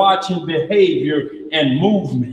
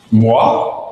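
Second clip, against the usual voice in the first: mock French "Moi?", whispered and laughing; 9 semitones lower than usual. Peter denying Jesus in mock French, laughing. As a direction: play Peter dodging in fake French. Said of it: "try to go French on him".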